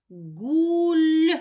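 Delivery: Гуль drawn out, long steady vowel; sound stretching. Shown as a demonstration. Teacher sounds out the syllable, drawn out. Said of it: "Позначаю кружечком".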